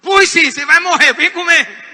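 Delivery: loud, high angry retort; imitating a furious mother; snapped mock retort. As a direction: throw the words back in anger.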